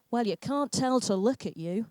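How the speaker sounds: normal talking voice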